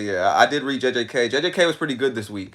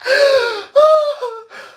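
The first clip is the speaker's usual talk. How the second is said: high wailing laugh; squealed, exhausted laughter; crying with laughter; 4 dB louder than usual. Real, not acted. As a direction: let out a helpless laughing howl. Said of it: "I'm crying".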